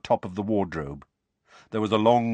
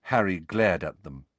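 ordinary delivery

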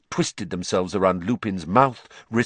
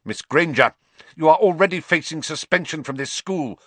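raised, forceful reprimand; spitting anger; 6 semitones higher, 5 dB louder than usual. A narrator's reading. Snape rebukes Miss Granger, spitting angrily. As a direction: spit the words out, louder. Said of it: "Snape spat".

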